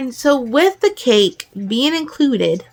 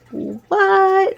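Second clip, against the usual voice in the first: high, shocked "What?"; surprised; 10 semitones higher than usual. Retold or imitated. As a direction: squeak it out in disbelief. Shocked at two-point chili.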